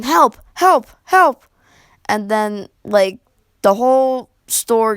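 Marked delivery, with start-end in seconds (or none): 0.0-1.4 high, loud yells for help, rising each time; repeated loud cries of help, pitch climbing; retold screaming for help. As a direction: shout help like a panicked kid on stage. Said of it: "he's just screaming".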